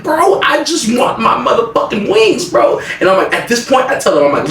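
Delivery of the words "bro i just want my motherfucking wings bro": high, drawn-out whining protest; exasperated plea. Retold or imitated. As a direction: plead slowly, pitched way up. Acted exasperated quarrel in retold story.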